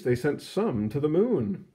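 ordinary delivery, nothing marked

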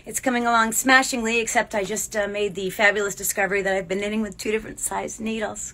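ordinary talk

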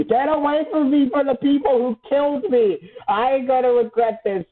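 high-pitched yelled warning; defiant shouting. Real, not acted. Player warning the people who killed them, defiant shouting. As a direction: shout threats at the ones who got you.

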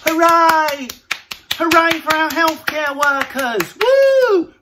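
high, hammed-up cheering neighbour; mock doorstep cheer. Neighbours on doorsteps cheering the NHS, hammed-up high whoops. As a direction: cheer like a smug clapper on the doorstep. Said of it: "all on their doorsteps going".

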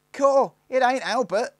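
very high, loud, drawn-out exclamation; exclaiming. A narrator's reading. Youngest Snarget sees it isn't Albert, high loud exclamation. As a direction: cry it out in high-pitched surprise. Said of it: "exclaimed the youngest Snarget".

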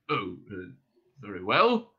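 put-on old man caught off guard; taken aback. A narrator's reading. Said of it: "slightly taken aback".

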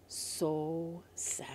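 hushed, slow and sorrowful; slow, fading storyteller's sadness; sad. A narrator's reading. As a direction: let it sink away softly. Boy leaves, story's sad end.

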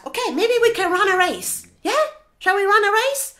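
high, loud, enthusiastic proposal; eager hippo. Acted. Hippo suggesting a race to flamingo, loud and eager. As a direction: pitch the game with big excitement. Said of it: "Hippo says".

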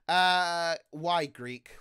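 usual speaking voice

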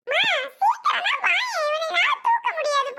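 high-pitched cry for help; urgent calling out. Acted. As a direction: call mother over in a panic. (பதற்றத்துடன் உதவிக்கு அழைப்பு)

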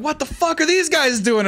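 high, incredulous what-the-fuck; baffled soldier. Retold or imitated. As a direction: sound thrown by their madness. Mexican soldiers baffled by Alamo defenders.

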